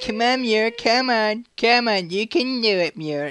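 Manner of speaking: put-on very high, babying mum; coaxing mum voice. Mum coaxing son along the rocks, high mock encouragement. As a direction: coax as if to a puppy, high-pitched. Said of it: "like, coaxing me".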